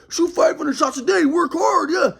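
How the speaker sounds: hammed-up pushy coach; mocking dad. Son mocking dad's shooting drills, high mock voice. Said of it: "he's like".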